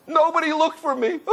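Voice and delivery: high wail dissolving into imitated sobs; acted childish bawling; weeping boy. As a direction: cry it out like a hurt little child. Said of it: "the boy started weeping heavy, heavy".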